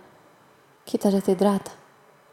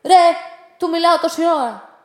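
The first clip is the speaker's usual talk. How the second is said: indignant protest; 7 semitones higher, 9 dB louder than usual. Acted. Man argues back with the porter.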